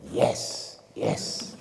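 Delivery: a soft, breathy, gloating yes; quietly triumphant voice; hushed yes. Retold or imitated. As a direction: hiss it like a secret win.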